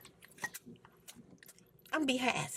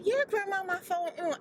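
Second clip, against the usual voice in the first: granddaughter voice; 7 semitones higher than usual. Retold or imitated.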